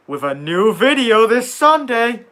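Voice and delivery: loud, high, drawn-out announcer shout; hyped channel plug. Pretend. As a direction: belt out the plug like a hype announcer. Plugging the channel's new video.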